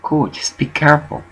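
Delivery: soft, quiet calming of him; soothing hush. Retold or imitated. Calming Orville quietly after he yells at a worker. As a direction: settle him down gently. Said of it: "I said".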